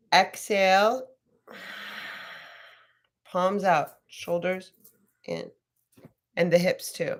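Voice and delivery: slow, spaced-out yoga instructions; yoga cueing. Acted. Yoga teacher talks class through arm pose, slow cueing. Leads yoga breathing cues.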